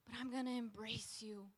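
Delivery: softened, tender vow; embracing surrender. Acted. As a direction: let the protest fall into quiet trust. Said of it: "to embracing".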